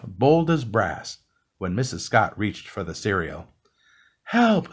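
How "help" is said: very high storybook shriek, only a little louder; mock scream, pitched way up; short high cry for help. A narrator's reading. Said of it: "screamed Missus Scott".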